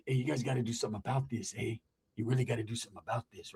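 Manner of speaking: low, very soft croaky aunt; mock sore-throat voice. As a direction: imitate an aunt straining through a sore throat. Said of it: "Why are you talking like that?".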